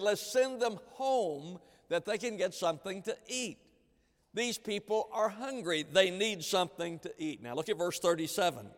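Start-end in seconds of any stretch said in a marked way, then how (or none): 0.0-7.4 higher, urging voice of the disciples. Acted. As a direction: press the point as anxious followers. Preacher voices disciples' suggestion.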